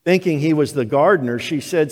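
normal talking voice

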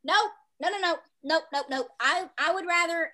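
ordinary talk

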